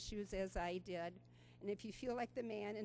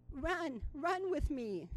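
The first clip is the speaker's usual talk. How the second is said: put-on higher child voice begging to run; eager toddler; 6 semitones higher, slower than usual. Toddler urging great-grandma to run, higher child voice. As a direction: coax grandma into a run like a little kid.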